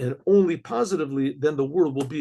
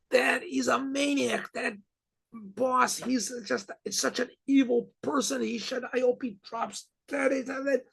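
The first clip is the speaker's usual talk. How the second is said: mock screaming; 8 semitones higher, 3 dB quieter than usual. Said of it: "Gentiles screaming at each other".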